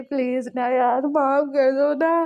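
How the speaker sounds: put-on tired boy loudly begging his friend for mercy; drawn-out pleading. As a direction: stretch the words out, begging. V begs forgiveness.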